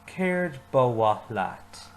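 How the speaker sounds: very slow, clear repeat; slow repeat-after-me. Shown as a demonstration. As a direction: lead the class slowly through it. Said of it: "Say it with me".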